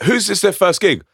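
high-pitched retold stage call; calling to crowd. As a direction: ask it as if to a whole audience. Retelling question called to crowd.

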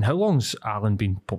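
normal talking voice

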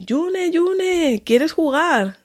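put-on high, sing-song calling of a little girl's name; enthusiastic call. Mother calling her toddler to play, high and excited. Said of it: "toda entusiasmada, la llamé".

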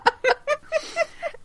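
high giggling burst; high laughing fit; high burst of laughter. Real, not acted. Laughing at the retold scene.

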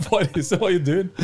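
high-pitched, incredulous question; mock disbelief. Player recalling his thought at a teammate, mock disbelief. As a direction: ask it as if appalled, half joking. Acting out his reaction to play.

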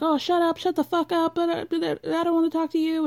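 high, fast snapping brush-off; snappy. Retold or imitated. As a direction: snap at them to back off. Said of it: "gets very snappy very quickly".

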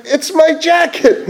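high, slow, thrilled cry of discovery; amazed delight. Retold or imitated. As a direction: shout it like you just found your lost treasure. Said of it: "I couldn't believe it".

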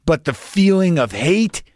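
heated monologue building to an insult; scornful rant. Real, not acted.

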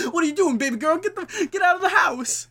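very high, loud mock holler; frantic yelling. Homeless man chasing her into the house, hammed-up frantic yelling.

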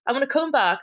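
ordinary talk, nothing marked

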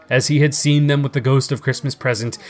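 ordinary delivery

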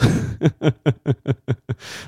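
long chuckle in quick even pulses; steady rippling laugh. Real, not acted. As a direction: let a string of chuckles run on. Laughing at own retold secret.

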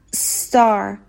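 استار begun on a long hiss running into "tar"; drawn-out initial s. Shown as a demonstration. Teacher demonstrates joining s to next letter, stretched s. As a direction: stretch the s of استار, no vowel before it. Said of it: "س رو بچسبونیم به حرف بعدی".

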